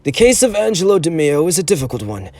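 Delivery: put-on mild old angel of mercy; elderly defender. A narrator's reading.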